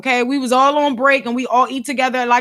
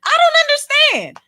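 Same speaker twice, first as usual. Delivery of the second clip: high, strained disbelief; incredulous squeak; 11 semitones higher than usual. Real, not acted. Vlogger baffled by coworker, high squeak. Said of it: "I be trying, child".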